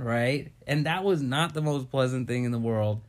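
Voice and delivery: normal talking voice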